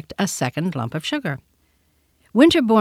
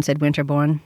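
ordinary delivery, nothing marked

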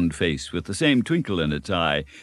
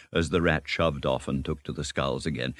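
ordinary delivery, nothing marked

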